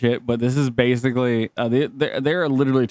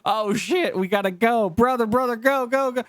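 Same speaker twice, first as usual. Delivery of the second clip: panicked cop; 9 semitones higher than usual. Retold or imitated. Acting cop in a chase.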